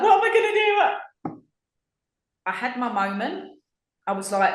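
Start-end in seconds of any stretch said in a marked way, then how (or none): 0.0-1.4 high panicked wail; mock panic. Fretting over money, much higher panicked cry. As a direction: squeal it like a drama queen. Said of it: "And I was like".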